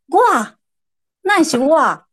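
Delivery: loud, high incredulous "me?"; surprised disbelief. Retold or imitated. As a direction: balk in shock at being picked. Shocked at being told to cry. (难以置信地惊叫)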